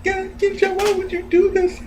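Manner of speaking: high, wailing hammed-up sob; mock crying lover. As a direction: plead like a jilted lover, overdone.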